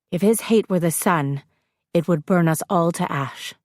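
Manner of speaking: ordinary delivery, nothing marked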